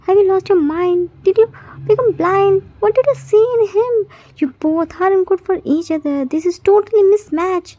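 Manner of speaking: a mother telling off her son; angry mom scolding her son. Acted.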